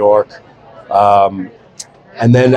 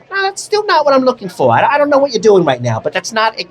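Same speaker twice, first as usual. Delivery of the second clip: much higher, put-on lady's voice; casting director voice; 9 semitones higher than usual. Casting director rejecting his second take, high mimicking voice. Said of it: "She's like".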